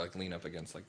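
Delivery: ordinary talk, nothing marked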